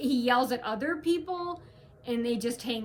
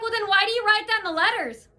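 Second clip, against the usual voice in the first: louder and higher, indignant demand; retelling an angry challenge; confronting; 8 semitones higher, 6 dB louder than usual. Retold or imitated. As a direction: demand an answer, fed up. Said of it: "I was like".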